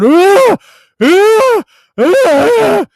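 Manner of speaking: high, loud mock screams; imitated screaming. Reviewer mimicking the heroine's last scene, loud mock screams. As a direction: scream like the actress in the final shot. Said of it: "staring at the camera going".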